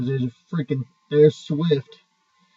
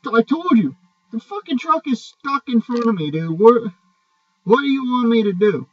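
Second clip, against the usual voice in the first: higher, louder heated comeback; angry retort; 7 semitones higher, 3 dB louder than usual. Retold or imitated. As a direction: snap back at the tow driver. Retold shouting match with driver.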